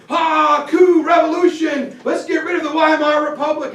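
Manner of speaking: hammed-up revolutionary yell; mock coup shout. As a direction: rant like a madman on a beer hall table. Hitler shouting on a table.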